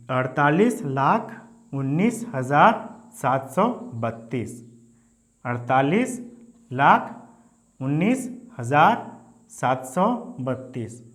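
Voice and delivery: slow careful reading. Shown as a demonstration. Teacher reads the full number slowly for students. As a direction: spell the number out slowly, chunk by chunk. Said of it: "साथ में पढ़ना पड़ेगा".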